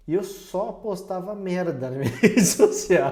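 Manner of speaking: breaking into loud laughter; laughing confession. Real, not acted. Podcast guest owning up to silly old posts, laughing.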